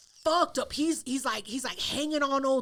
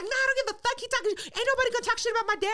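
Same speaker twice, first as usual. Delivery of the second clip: high, fast defiant rant; mock irate daughter, pitched way up; imitated outrage; 8 semitones higher, much faster than usual. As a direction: rant over anyone trying to calm you. Furious daughter ranting on.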